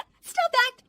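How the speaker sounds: high loud commanding "Stop that"; triumphant command. Acted. Daughter-in-law practises the command in the mirror, loud. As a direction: bark the command.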